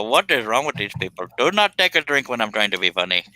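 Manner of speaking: ordinary talk, nothing marked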